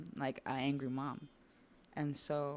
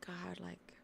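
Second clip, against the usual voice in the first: hushed, quick, whispery pleading; begging; 3 semitones higher than usual. Retold or imitated. Scared kid asking God for mercy from mom, whispered begging. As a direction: re-enact a scared kid's frantic whispered prayer. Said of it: "all this begging and reckoning".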